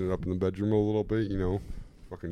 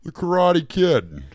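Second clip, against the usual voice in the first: high, loud, slow movie title shout; announcer shout; 12 semitones higher, 11 dB louder, slower than usual. Pretend. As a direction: bellow the title like a trailer.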